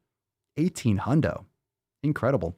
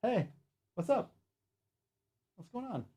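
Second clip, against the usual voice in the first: dog talk; 8 semitones higher, 12 dB quieter than usual. Real, not acted. Host asking his dog what's up, soft baby talk. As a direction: coo at the dog gently.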